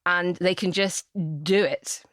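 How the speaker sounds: ordinary talk, nothing marked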